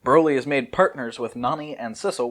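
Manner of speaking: normal talking voice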